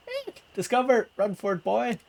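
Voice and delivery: high panicked gnome; squeaky gnome voice. Acted. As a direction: squeal and flee.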